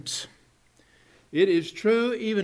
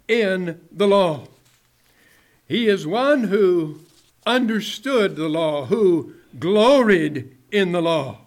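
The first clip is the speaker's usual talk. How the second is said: louder, driving pulpit delivery; fervent preaching; 2 semitones higher, 4 dB louder than usual. Acted. Preacher pressing the point to his congregation, fervent. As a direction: preach it out with rising fire. Preaching builds to full force.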